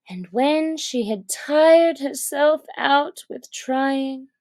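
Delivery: ordinary delivery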